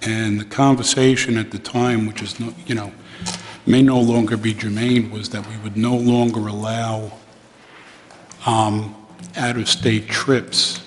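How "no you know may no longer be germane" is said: ordinary talk, nothing marked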